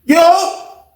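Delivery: high-pitched shouted answer; sudden high call. Retold or imitated. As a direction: holler back at the door. Answering a knock at the door.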